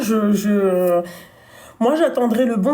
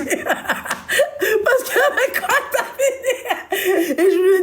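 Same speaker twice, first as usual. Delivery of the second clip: high, slow, laughter swallowing the words; laughing hard; 9 semitones higher, much slower than usual. Real, not acted. Wife laughs retelling her husband's celebrity crush. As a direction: crack up mid-question.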